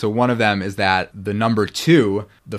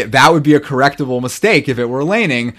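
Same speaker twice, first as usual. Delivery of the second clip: normal talking voice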